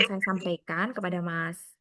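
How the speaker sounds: normal talking voice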